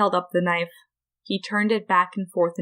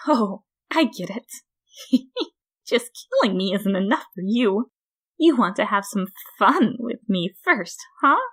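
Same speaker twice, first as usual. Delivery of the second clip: sly Flowey; 3 semitones higher than usual. A narrator's reading. Grinning flower teases the human, sly. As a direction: tease with a grin.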